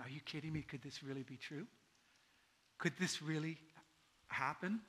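very soft, low disbelieving wonder; low, hushed questioning with a halting finish; hushed amazement. Acted. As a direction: barely dare to believe it. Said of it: "because of joy and amazement".

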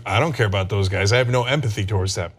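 low, callous mock voice; deep indifferent caricature; mock conservative voice. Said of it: "they're like".

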